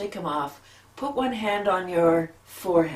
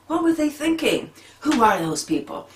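indignant; 8 semitones higher, 4 dB louder than usual. Retold or imitated. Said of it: "you're just like".

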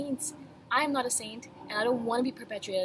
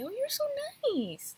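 lightly mimicked gushing compliment, high on Oh; a sweet, high-pitched mock of a new acquaintance; a hint of a fawning admirer; gushing people. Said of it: "they always say".

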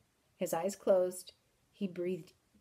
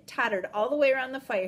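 ordinary delivery

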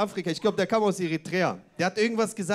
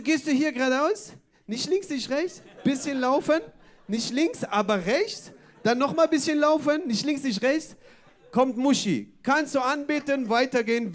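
higher, singsong list with rising ends; put-on higher voice, phrase by phrase; imitated passerby giving directions; 5 semitones higher, 3 dB louder than usual. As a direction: mimic a stranger eagerly reeling off directions. Same passerby's directions, acted.

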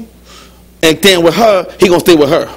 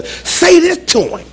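high, slow, drawn-out coaxing; voiced imitation of an urging little demon; mock whispering tempter; 10 semitones higher, slower than usual. Demon perched on your shoulder egging on a spouse, mock coaxing. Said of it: "They're whispering words".

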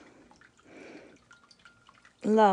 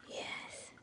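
whispering; 18 dB quieter than usual. Real, not acted. Baby talk to the pet.

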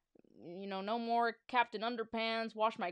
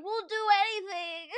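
high, louder desperate promise; desperate plea; 10 semitones higher, 6 dB louder than usual. Acted. Boys plead with the principal, high desperate plea. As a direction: beg him, promise anything. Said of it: "the boys cried".

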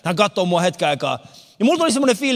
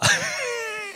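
high laugh burst; 6 dB quieter than usual. Real, not acted. Laugh at Mufasa joke.

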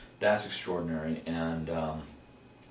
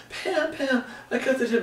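high-pitched frantic plea; hammed-up squeal of alarm; freaking out; 3 dB louder than usual. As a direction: call for help in a mock panic. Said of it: "I'm freaking out".

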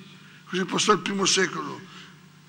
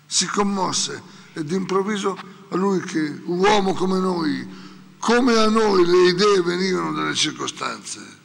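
ordinary delivery

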